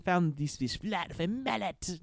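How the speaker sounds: a cartoon French chef in a French accent. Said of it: "the French accent".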